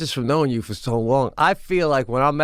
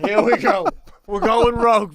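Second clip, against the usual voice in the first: higher-pitched, laughing mock dread; mock alarm; 6 semitones higher, 3 dB louder than usual. Scroll operator watching Biden leave the script, mock alarm. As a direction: brace comically for the speech going off the rails.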